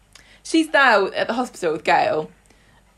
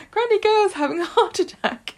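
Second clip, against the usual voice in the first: put-on child Sam; 12 semitones higher than usual. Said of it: "he's like".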